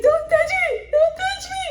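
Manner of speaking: very high, loud mock scream; shrieked protest. Wife to father-in-law touching her, shrieking. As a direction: cry out in panic to fend him off.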